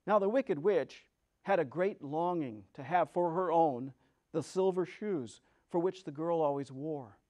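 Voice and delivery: ordinary delivery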